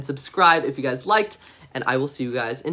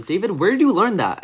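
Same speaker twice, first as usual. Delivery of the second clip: lightly voiced shocked parent, higher and louder; scolding parent; 6 semitones higher, 5 dB louder than usual. Parents asking David about naughty words, mildly shocked scolding. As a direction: question him like an appalled parent. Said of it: "his parents told him".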